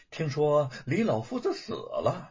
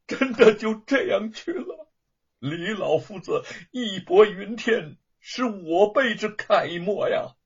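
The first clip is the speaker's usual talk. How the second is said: grieving lament; 6 semitones higher, 4 dB louder than usual. A narrator's reading. Wu Chengen mourning his teacher, grieving. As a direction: mourn the old teacher, voice catching. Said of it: "感慨又悲痛地说".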